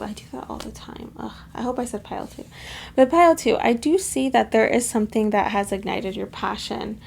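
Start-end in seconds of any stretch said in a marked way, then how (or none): 0.0-2.4 whispering to herself, annoyed; muttered self-scolding under breath; whispered aside. Real, not acted.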